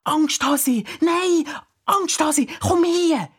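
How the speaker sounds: very high, urgent call to a dog; frantic high-pitched child calling. A narrator's reading. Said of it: "rief der Dure".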